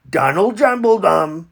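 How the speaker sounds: ordinary talk, nothing marked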